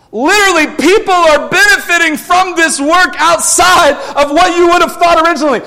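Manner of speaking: loud, high preacher's build; fervent preaching. Acted. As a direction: push it hard from the pulpit. Preaching climax.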